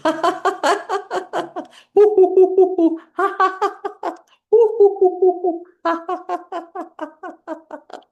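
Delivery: high, loud panting hoo-hoo laugh; hot food laughter. Shown as a demonstration. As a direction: blow out the heat while laughing. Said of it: "fanning your mouth with laughing".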